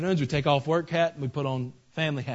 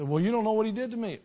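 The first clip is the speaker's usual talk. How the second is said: mock complaint; 7 semitones higher, faster than usual. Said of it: "You say".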